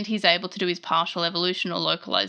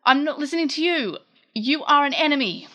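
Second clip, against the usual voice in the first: higher-pitched mock indignation; defiant refusal; 6 semitones higher than usual. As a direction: act out an angry character refusing to hear. Retold angry Wizamon refusing.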